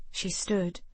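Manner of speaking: plain reading voice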